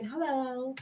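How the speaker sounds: a dainty, posh little greeting; posh phone hello. Retold or imitated. Answering her date in her posh mood, soft high hello. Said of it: "'cause I'm in my posh era".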